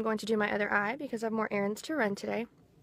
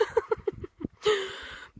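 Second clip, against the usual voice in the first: short high embarrassed giggle; embarrassed laugh; 10 semitones higher, 4 dB quieter than usual. Real, not acted. Embarrassed about crying on video.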